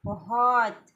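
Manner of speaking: loud shouted word to show them how; full-voice shout to lead the group; shouting demo. Shown as a demonstration. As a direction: belt it out to release anger. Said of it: "तेज़ी से चिल्लाइए".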